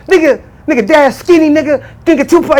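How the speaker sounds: high, loud insistence; heated insisting. Retold or imitated. Arguing with his brother about Dash, high and loud. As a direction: argue your point hard.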